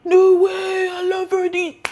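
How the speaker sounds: high-pitched, hammed-up enthusiasm; over-the-top squeal of recognition; gushing mock delight. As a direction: imitate a customer gushing with delight. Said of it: "he would have been like".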